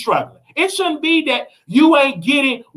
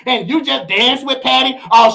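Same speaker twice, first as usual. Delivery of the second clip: ordinary talk, nothing marked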